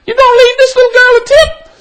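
high, quick imitation of a wife; perky wife piping up. Wife interrupts husband to ask about the tip, perky piping. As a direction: pipe it up high and fast, imitating her. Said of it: "piped up".

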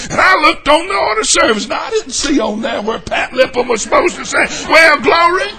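high, loud, worked-up tattling; hammed-up indignant church member; whiny scandalized complaint; mock complaining deacon.

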